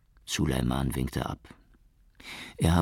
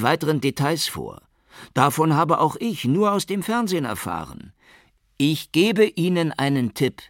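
higher, louder voice of a terse police spokesman; official at a press conference; 11 semitones higher, 7 dB louder than usual. A narrator's reading. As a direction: stonewall the reporters, then pass the buck.

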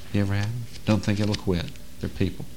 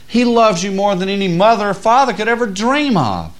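loud, high, driving sermon voice; preaching at full force; 8 semitones higher, 5 dB louder than usual. Acted. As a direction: build the fire of the sermon.

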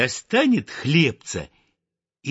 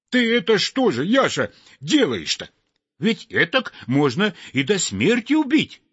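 scolding; 7 semitones higher than usual. Acted. Sparrow scolds the chimney sweep.

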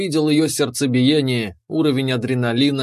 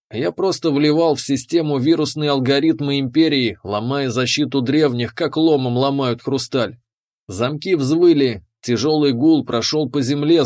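ordinary delivery

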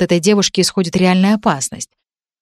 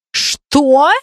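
higher, sharp indignant "Что?"; a girl losing her temper, higher and louder; furious outburst; 7 semitones higher, 4 dB louder than usual. Acted. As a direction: fling the word back at him in rage. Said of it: "ещё больше выходя из себя".

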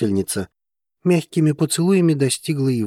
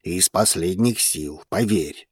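slow, slightly lowered gloomy voice; grim; 4 semitones lower, 3 dB quieter, slower than usual. A narrator's reading. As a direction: say it slowly and darkly. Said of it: "мрачно предрёк Зейн". (мрачно, медленно, пониженным голосом)

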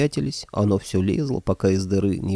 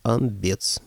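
plain reading voice

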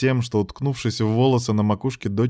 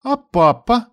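put-on little girl voice; 13 semitones higher, 5 dB louder, slower than usual. A narrator's reading. Child asks about father.

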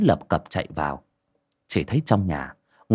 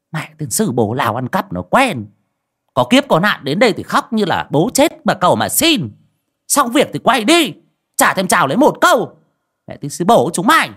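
much higher, louder spiteful sorceress rant; scornful cursing; 10 semitones higher, 5 dB louder than usual. Acted. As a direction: spit the curses out, rising in pitch and volume. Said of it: "Bà thầy nguýt dài". (bà thầy chửi theo khách, giọng cao, to, khinh bỉ)

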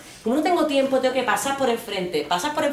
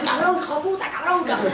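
higher cursing back; mock angry cursing; 8 semitones higher than usual. She swears back at Salvadoran catcallers, higher and heated. Her retold furious reply.

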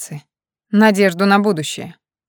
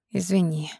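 quiet apology; 7 dB quieter than usual. A narrator's reading. Vlada apologising after being cut off, quietly. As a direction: say it quietly, backing down. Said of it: "тихо проговорила она".

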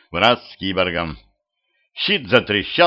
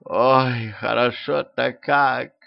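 slow, sighing moan; drawn-out pleasured sigh on the words; moan of pleasure; much slower than usual. A narrator's reading. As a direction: moan it out blissfully. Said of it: "со стоном опустилась".

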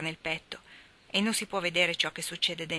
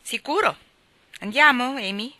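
eager; 7 semitones higher, 8 dB louder than usual. Acted. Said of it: "disse Jo".

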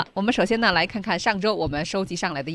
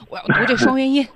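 higher, louder, overdoing the fretful student; mock nervous student; 7 semitones higher, 6 dB louder than usual. Teacher mimicking students fretting over the diphthong, hammed-up. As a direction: play a tense pupil fixated on the 双元音. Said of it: "都觉得说".

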